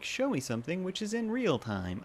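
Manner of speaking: high-pitched elfin robot American; robotic American accent. Retold or imitated. Quoting the real-time counter trend, high robotic American accent. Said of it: "trying to do this robotic American accent".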